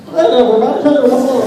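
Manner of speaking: high chicken monster greeting everyone. Acted. Voice actor stuck in his monster voice everywhere, high-pitched. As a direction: greet them in a silly high creature voice. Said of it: "that voice got s-, that stuck with me".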